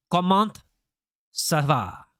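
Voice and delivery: halting first try at French with a French accent. Shown as a demonstration. Streamer greeting viewer Sana in French, careful French accent. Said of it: "I can say it in French".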